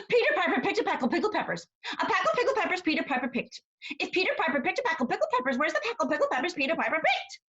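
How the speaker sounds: rattled off fast and bright; very quickly. Shown as a demonstration. Mother Goose showing the children a tongue twister, very fast. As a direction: race through the tongue twister. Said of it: "a rhyme that you say very quickly".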